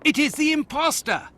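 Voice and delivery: high, urgent alarm; alarmed cry. Acted. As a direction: raise the alarm about the intruder. Guard spots intruder.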